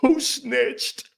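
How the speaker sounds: high mocking whine; whiny high mock voice. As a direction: play the offended star. Mocking the star again.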